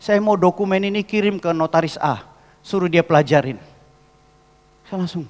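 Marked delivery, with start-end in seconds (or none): 0.0-3.6 loud, raised, insistent demand; mock irate instruction; imitated angry order. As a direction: play the client laying down angry orders. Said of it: "Marah-marah dia".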